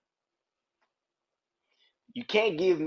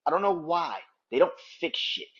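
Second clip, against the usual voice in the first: ordinary talk, nothing marked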